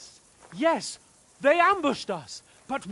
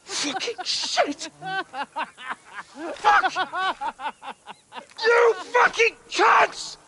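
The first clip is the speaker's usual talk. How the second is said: furious shouting; 7 semitones higher, slower than usual. Acted. Cursing guards who refuse entry.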